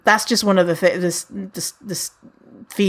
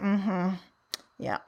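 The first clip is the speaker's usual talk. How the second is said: small, quiet, reluctant assent; grudging agreement; 10 dB quieter than usual. Retold or imitated. Jealous podcaster answering a recommendation, grudging and quiet. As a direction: agree under your breath, unimpressed.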